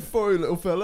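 mock British accent. Quoting the accent.

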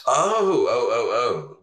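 lightly voiced lizard villain. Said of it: "waiting for your lizard voice".

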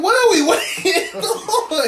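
slow, high question broken by a laugh; laughing disbelief. Retold or imitated. Friends waiting at two A M, asking through laughter. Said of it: "I'm like".

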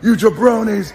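high-pitched wrestler's taunt; performed catchphrase shout; wrestling promo. Acted. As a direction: call out opponents, hammed up. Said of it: "start saying it in my promos".

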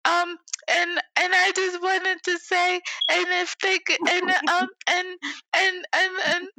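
put-on high, halting child voice; high-pitched kid stumbling over the sentence; stammering little kid. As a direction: keep restarting like a kid searching for words. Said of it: "where the kid be like".

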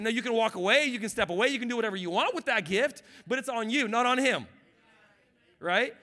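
loud, high preaching voice; preaching at full force. Acted. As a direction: preach it hard to the church.

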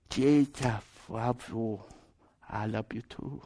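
put-on hushed, low broken English, Filipino accent; very softly imitate her Filipino-accented words. Filipino woman murmurs to little boy on bus, soft Filipino accent. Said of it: "she would whisper that in his ear".

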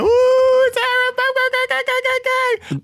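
hyped shout. Acted. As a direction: shout it like a party host. Hyped intro chant.

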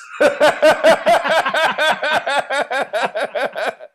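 loud high laughing fit; burst of laughter. Real, not acted. Storyteller cracking up at his own story, loud high laugh. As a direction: crack up at the punchline.

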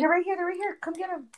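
high-pitched, urgent game callout; urgent alert. Real, not acted. Player calls teammate over to enemies, urgent alert. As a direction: call for backup, pitch jumping up.

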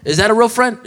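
loud, high rhetorical question; emphatic challenge. Real, not acted. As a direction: call them out, punching it.

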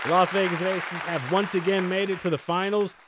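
proud, projected sports shout-out; announcer shout-out. Acted. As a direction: kick off the segment with a big announcement. Restarts the team's shout-out.

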